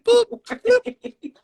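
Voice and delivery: squeaky high electronic bleep; high robot boop. Pretend. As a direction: chirp it like a censor beep. Mock censor bleep.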